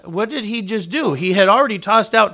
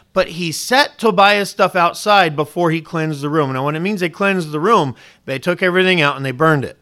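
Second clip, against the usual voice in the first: ordinary delivery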